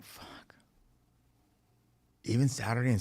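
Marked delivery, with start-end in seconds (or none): 0.0-0.5 a defeated whisper; whispered curse. Retold or imitated. Told to work it every day, a resigned whispered curse. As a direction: let the word out as pure breath.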